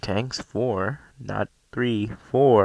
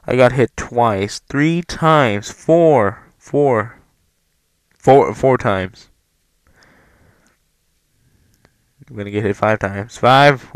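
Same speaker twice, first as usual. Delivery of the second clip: raised voice, punching out each number; exasperated counting; 7 dB louder, slower than usual. Real, not acted. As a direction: count the hits in mounting exasperation. Exasperated count of repeated hits.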